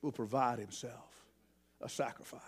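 low, soft, hushed, ending in a whisper; voice of faith. Acted. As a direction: say it as Abraham, quiet and sure. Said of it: "in the voice of faith".

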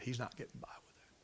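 fast, seething whisper; fierce whisper. Retold or imitated. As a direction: hiss the threat under the breath. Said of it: "He was outraged".

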